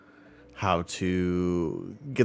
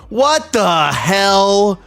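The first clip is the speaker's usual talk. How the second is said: high, loud, slow mock indignation; mock outraged shout; 10 semitones higher, 6 dB louder, slower than usual. Streamer mock-shouts at a viewer named Heretic, hammed-up outrage.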